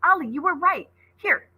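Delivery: high, bright excitement; excited. Acted. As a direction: celebrate the discovery. Coco excited.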